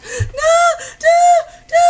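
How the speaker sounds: high, loud, wailing shout; re-enacted desperate wail; panicked crying protest. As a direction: cry out in acted-out agony. Retold panic during bleeding procedure.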